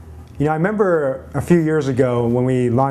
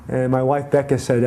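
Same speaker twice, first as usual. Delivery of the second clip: ordinary talk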